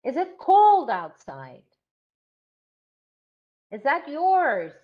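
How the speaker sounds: slow, exaggerated model question; rising intonation. Shown as a demonstration. Teacher drilling yes/no questions aloud, slow rising tune. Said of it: "So we have to practice".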